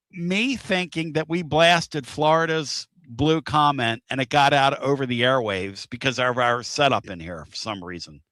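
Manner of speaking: ordinary talk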